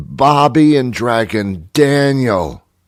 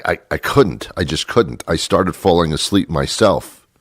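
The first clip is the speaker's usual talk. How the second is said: ordinary talk, nothing marked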